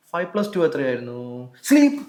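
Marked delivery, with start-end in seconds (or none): 1.7-2.0 sharp, high, loud order; startling one-word trance trigger; sudden hypnotic command. Real, not acted. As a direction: fire the word out abruptly to drop them into trance.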